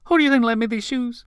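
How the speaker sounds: very high, quick drag queen comeback; gleeful retort. Acted. Marilyn teases Rick about the borrowed shoes, high and gleeful. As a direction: squeal the question, fast and amused.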